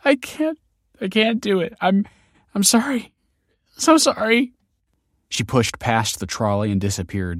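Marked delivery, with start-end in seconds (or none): 0.0-4.5 very high, breaking into tears; weeping mother, overwhelmed; tearful. A narrator's reading. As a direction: cry through an anguished apology. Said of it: "she welled up with tears".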